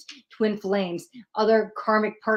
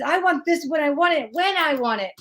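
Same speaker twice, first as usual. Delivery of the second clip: high, insistent mock tantrum; demanding control; 6 semitones higher than usual. Reader mocks her own demand for control, insistent high whine. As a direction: demand it like a spoiled child. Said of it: "and I'm freaking out".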